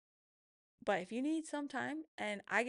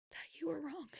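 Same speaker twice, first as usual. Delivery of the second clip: whispered; 6 semitones higher than usual. Real, not acted. Telling viewers the first step is admitting it, whispered. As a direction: whisper the last words like a confession.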